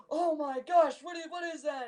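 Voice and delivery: high-pitched retold interrogation outburst; hammed-up high exclamation; mock screaming. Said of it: "they're screaming at him".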